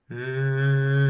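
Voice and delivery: steady hum in the face; gentle hum. Shown as a demonstration. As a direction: buzz the hum into the nose. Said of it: "do the same hum".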